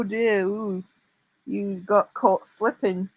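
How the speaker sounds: normal talking voice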